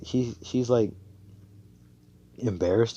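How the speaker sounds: plain reading voice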